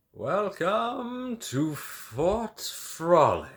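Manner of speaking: slow, high, drawn-out showman welcome; grand announcement. Acted. Cohen welcoming visitor to his venue, slow grand announcement. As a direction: announce it like a ringmaster.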